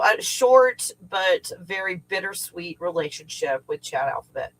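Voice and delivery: normal talking voice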